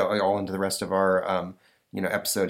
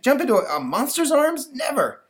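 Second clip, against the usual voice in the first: high, loud flat refusal; defiant kid; 5 dB louder than usual. Acted. Boy refusing to jump to a monster, high and defiant. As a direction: dig in your heels.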